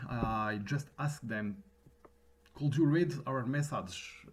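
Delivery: English with a pronounced French accent; thick French accent in English. Real, not acted. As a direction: speak English with strong French R sounds. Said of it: "mon accent français est prononcé, notamment mes R".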